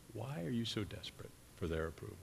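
soft, searching appeal; hushed, tender question. Acted. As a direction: let the question drop to a hush. Voicing Jesus, repeats question softly.

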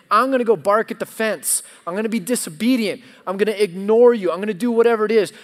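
loud, fast, stubborn rebel talking back; defiant outburst. Retold or imitated. A stubborn heart defying God, loud and fast. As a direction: fire it off like a kid refusing orders. Defiant self voiced in sermon.

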